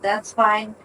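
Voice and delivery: normal talking voice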